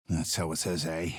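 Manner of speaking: normal talking voice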